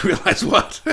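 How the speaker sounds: laughing through the words; amused, breaking into laughter. Real, not acted. Reacting to a viewer's comment, laughing.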